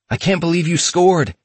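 excited scream. Retold or imitated. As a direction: scream it in disbelief and joy. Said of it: "he screamed".

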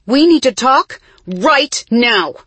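loud, high, slow demand. A narrator's reading. Isa confronting her twin before the family, angry shout. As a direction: shout it, landing hard on each word. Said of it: "raised her voice to her".

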